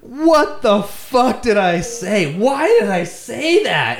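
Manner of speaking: high, slow, stretched-out disbelief; drawn-out exasperation. Retold or imitated. Storyteller appalled at what he wrote, drawn-out exasperated disbelief. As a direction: wail each word slowly at yourself. Retold horror at own words.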